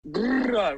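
high rolled "grrr"; tense shudder growl. Real, not acted. Recalling Kirsty grabbed by demons, high shuddering growl.

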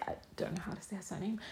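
quick, barely voiced admission; hushed aside. Real, not acted. Podcaster admitting she can't say a surname, hushed aside. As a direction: drop to a whispered, hurried aside.

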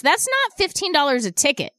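ordinary delivery

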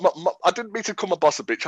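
ordinary talk

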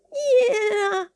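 put-on high, frail old woman's voice; weak trembling grandmother. Grandmother in bed asks who knocks, weak and trembling. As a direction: quaver it like a frail old lady. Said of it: "d'une voix faible et tremblante".

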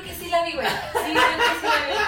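laughing. Real, not acted. Remembers the viral fight.

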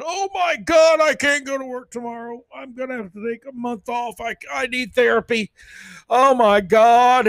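high, hammed-up panicked whine; mock offended person. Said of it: "a giant group be offended".

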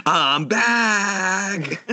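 high crying-out mock yell with a laugh behind it; Russell Case yell. Repeating the pilot's final line to his friend, high mock yell. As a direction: belt it high like a last stand. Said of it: "He unclips his oxygen thing".

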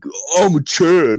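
mock the macho show-off, stretched out; mock Chad voice. Teller mocking husband acting cool, exaggerated Chad voice. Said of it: "Being all Chad-like".

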